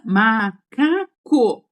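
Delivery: "macaco" very slowly, ma... ca... co; three drawn-out beats on "macaco"; syllable by syllable. Shown as a demonstration. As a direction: sound out each syllable of "macaco" separately. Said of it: "quando começa a contar sílabas".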